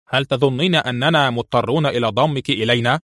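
ordinary delivery

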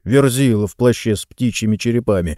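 ordinary delivery, nothing marked

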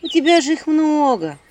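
high loud coaxing; persuading. Acted. Heroine persuading the stingy spirit. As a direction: try to talk him into it. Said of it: "убеждала я".

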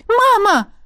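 high frightened cry; испуганный крик. Acted. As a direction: cry out in alarm. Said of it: "воскликнула Эветта". (испуганный вскрик)

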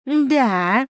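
high, loud mocking echo; mimicking. A narrator's reading. Mouse mimics the heroine's scoff, mockingly. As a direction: parrot it back to tease. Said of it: "передразнил меня мышь".

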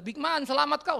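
high, loud call from far above; God hollering down from heaven; mock shout from heaven. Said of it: "Teriak aja dari surga".

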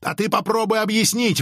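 высокий требовательный выкрик, не громкий; требуй ответа резко повышенным голосом. A narrator's reading. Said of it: "выкрикнула Анги". (выкрикивая)

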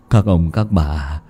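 pleading. Acted. Hoạch begging the villagers, low, slow and soft. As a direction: beg the crowd humbly.

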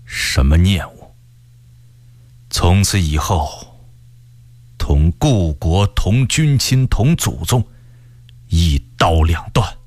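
low, slow and weighty; heavy, grave tone. A narrator's reading. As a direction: pronounce a final break with the past, slowly and gravely. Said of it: "语气沉重地说".

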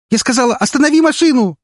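high, loud angry yell on the edge of tears; angry shout near sobbing. A narrator's reading. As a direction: yell at the driver, close to sobbing. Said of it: "Зло, почти срываясь в рыдание, закричала".

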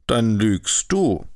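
slow, odd-voiced accusation; accusing. A narrator's reading. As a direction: call him a liar to his face. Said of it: "riefen jene". (langsam, anklagend)

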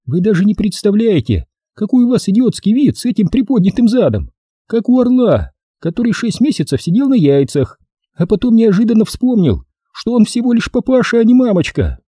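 high-pitched female character voice, teasing; sassy lady, pitched high; teasing woman. Acted. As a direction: play a teasing woman well above your own pitch. Лайза teases the narrator.

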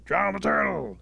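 high, very loud group cry; mob shout. Acted. All the wolves condemning turtle to drown, shouting. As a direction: belt it out as the whole pack.

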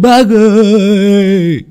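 high, slow, drawn-out shout; long calling cry. Acted. Boy outside the door calling old Hà, long shout. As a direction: call out long and loud to be heard.

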